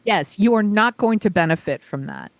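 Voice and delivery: ordinary talk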